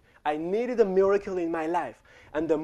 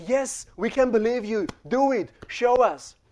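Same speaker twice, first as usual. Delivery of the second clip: higher, eager crowd call; mock eager crowd; crowd urging; 5 semitones higher than usual. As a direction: push him on like the crowd.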